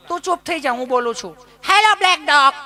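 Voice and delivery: high, bossy mock lady; mimicking bossy lady. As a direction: shush her then call out high. Educated lady takes over.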